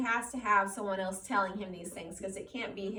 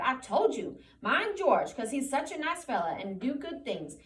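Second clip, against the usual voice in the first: put-on a loud, higher scolding old aunt; 4 semitones higher, 5 dB louder than usual. A narrator's reading. Aunt Clara scolds Lenny about George, loud high scolding. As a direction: wag a finger and scold him. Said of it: "it was in Lenny's voice".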